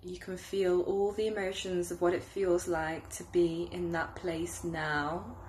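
unhurried meditation guide, growing hushed toward the end; guided meditation. Acted. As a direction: lead them gently through a visualization, softening as it deepens. Leads a guided visualization.

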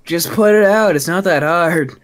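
high, loud mock shrug; mock dismissive comeback. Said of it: "And then he just looks around and goes".

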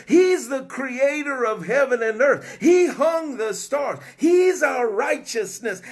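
preacher shouting praises to the congregation; full-force preaching. Real, not acted. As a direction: build the crowd up, proclaiming.

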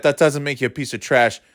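ordinary delivery

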